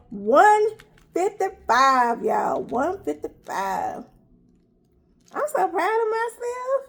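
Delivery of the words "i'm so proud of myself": high, proud announcement; beaming with pride. Real, not acted. Celebrating her weight loss.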